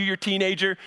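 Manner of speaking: normal talking voice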